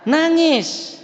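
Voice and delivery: put-on high wail on nangis, like a crying child; preacher mimicking a child crying; mock crying. Shown as a demonstration. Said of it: "mun takut mesti na".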